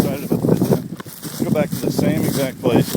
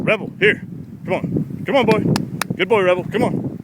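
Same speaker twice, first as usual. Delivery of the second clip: high, eager calls to the dog; coaxing call; 9 semitones higher, 4 dB louder than usual. Real, not acted. Trainer calling his retriever back to him, eager and high. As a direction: coax him back with bright urgency.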